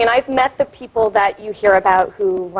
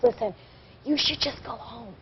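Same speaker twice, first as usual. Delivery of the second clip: much softer, slowed-down warning; hushed firm advice; 13 dB quieter than usual. Retold or imitated. Girl pulls her aside to send her home, hushed warning. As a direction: drop right down and take her aside slowly. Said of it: "and she's like".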